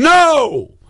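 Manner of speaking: sharp high startled no; retold scream. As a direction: replay the frightened yell at the streetwalker. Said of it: "I screamed".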